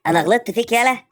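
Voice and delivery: loud indignant shout; angry retort. Acted. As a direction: fire it back furiously.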